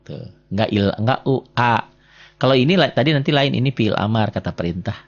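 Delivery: ordinary delivery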